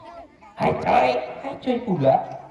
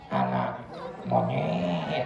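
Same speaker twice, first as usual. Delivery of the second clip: much lower cheeky puppet answer; 7 dB quieter, much slower than usual. Acted. Puppet Ucup says he wants to be a monkey, low. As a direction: drop deep and deadpan.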